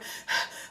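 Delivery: quick unvoiced breath out, tense; a short breathy sigh high in the chest; shallow sigh. Shown as a demonstration. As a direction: demonstrate shallow panicked breathing. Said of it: "We're breathing up here".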